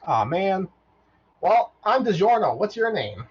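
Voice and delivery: high chirpy robot introducing himself; bright little robot making friends. Acted. Robot introducing himself to Arya, high and chirpy.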